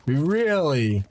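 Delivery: "Really" peaking early, then dropping low; high-to-low pitch. Shown as a demonstration. Streamer checking their own speech habit, "Really" falling high to low. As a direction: let "Really" slide down to a low end. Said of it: "start your words high pitch and then end them low pitch".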